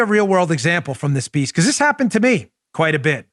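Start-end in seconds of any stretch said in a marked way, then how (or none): none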